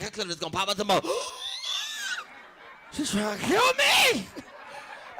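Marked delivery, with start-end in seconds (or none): 1.1-2.4 a sharp, very high, strangled gasp; choking gasp. Retold or imitated. 2.9-4.3 half-choked mock alarm; high, loud panic. Choking panic.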